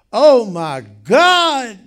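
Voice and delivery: loud, high, drawn-out mock scream; imitated child's scream. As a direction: holler it like an excited six-year-old. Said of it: "they got the six-year-old screaming".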